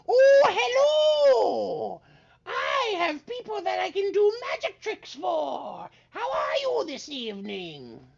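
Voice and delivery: high, shouting, over-eager wizard; excited wizard. Acted. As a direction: greet them like a giddy showman. GM voices excited wizard Lufty.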